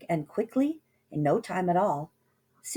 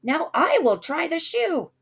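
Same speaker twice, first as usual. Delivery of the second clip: high, loud bossy sister; high, loud bossy sister's demand; yell; 10 semitones higher, 4 dB louder than usual. A narrator's reading. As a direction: shout your claim to the shoe. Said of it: "who yelled".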